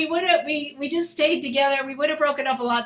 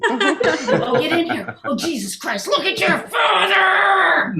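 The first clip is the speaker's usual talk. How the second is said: mom's outburst; 5 semitones higher, 4 dB louder than usual. Retold or imitated. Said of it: "she just hits me".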